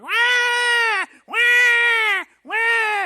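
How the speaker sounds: loud, piercing mock baby cry; imitated baby wail. Baby wakes parents at three in the morning, mock wailing. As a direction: wail like a hungry newborn. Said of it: "and the baby's like".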